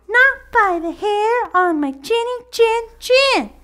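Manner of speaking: squealing little pig. Acted. Little pig refusing the wolf from inside, squealing. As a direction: squeal back defiantly. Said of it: "squealed the third little pig".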